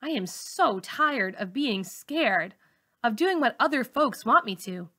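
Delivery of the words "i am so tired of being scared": plain reading voice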